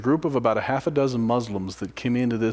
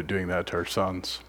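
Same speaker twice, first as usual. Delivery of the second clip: usual speaking voice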